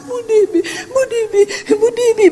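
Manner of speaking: a woman sobbing with joy as she calls her name; tearful calling out. Retold or imitated. Grateful woman calls to the preacher, tearful and high. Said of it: "Dari jauh udah nangis".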